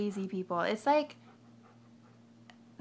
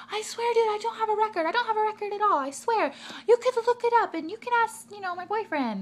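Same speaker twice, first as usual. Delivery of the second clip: much higher, louder protesting; imitating defensive girl; 13 semitones higher, 6 dB louder than usual. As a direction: swear to the clerk she has no record. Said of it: "she's like".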